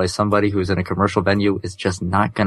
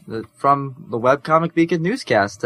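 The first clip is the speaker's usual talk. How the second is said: ordinary talk, nothing marked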